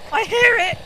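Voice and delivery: high loud frightened cry; panicked shout. Real, not acted. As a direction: blurt it out in panic. Panicked outburst.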